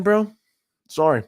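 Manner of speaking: usual speaking voice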